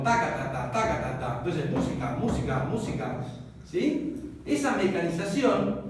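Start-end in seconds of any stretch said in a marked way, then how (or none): 0.0-1.4 taca ta ta taca ta ta, beats spoken low; rhythmic figure. Shown as a demonstration. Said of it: "me queda la figura como". 1.7-3.2 música said with each syllable beat even; música dicha en sílabas; syllable-timed. Shown as a demonstration. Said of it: "decir la palabra música".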